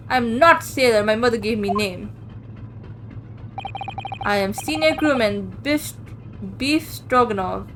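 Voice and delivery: gruff Russian seaman introducing himself; Russian accent. Acted. Seaman Stroganoff answers the detective in a Russian accent. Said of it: "sorry, I thought it was Sudato".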